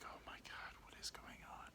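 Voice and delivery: very soft, bewildered whisper; acted hushed dismay; whispered disbelief. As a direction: whisper to yourself in mock panic. Private panicked thought.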